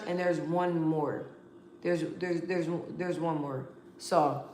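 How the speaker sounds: soft, fading, searching for the word; sleepy soft murmur; tired and quiet. Real, not acted. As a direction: trail off tiredly trying to remember. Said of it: "I'm yawning and stuff".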